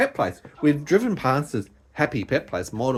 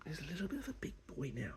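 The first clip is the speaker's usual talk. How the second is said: whisper; 21 dB quieter than usual. Real, not acted. Confiding about the cat.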